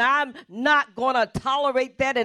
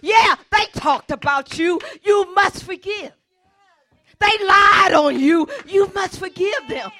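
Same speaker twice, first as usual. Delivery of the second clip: loud, pounding pulpit preaching; a pastor preaching at full force; 6 semitones higher, 5 dB louder than usual. Acted. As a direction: drive every "you must forgive" home to the congregation. Preaching to the church.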